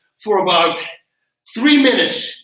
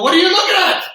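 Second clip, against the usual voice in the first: much higher, louder mock-tough challenge; tough guy challenge; 11 semitones higher, 5 dB louder, faster than usual. Instructor playing it cool to gaping students, loud and cocky. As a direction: toss it at them like nothing hurt. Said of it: "and I go".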